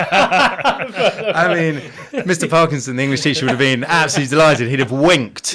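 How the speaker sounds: loud laughing talk through the words. Real, not acted. Telling the host about his English teacher, laughing loudly. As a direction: laugh while telling it, delighted.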